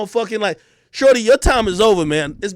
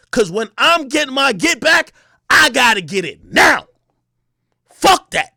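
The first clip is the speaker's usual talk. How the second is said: heated shout; 3 semitones higher, 4 dB louder than usual. Real, not acted. Heated boast about revenge.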